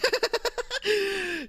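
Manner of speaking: a high, delighted burst of laughter; high giggling laugh. Real, not acted. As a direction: let a giggle run away with you. Laughing at the game's praise.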